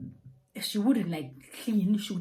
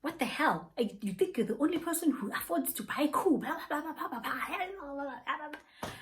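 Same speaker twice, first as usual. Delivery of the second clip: mock outrage; 7 semitones higher than usual. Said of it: "And then she was like".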